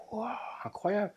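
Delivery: soft gasp of amazement, imitating wonder; awed wonder. Speaker relives seeing sky in a puddle, hushed wonder. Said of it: "en regardant ça, je fais".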